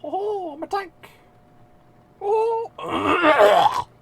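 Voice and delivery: badly faked squeaky cartoon voice, straining at the end; Mickey Mouse. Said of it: "Fucking Mickey Mouse".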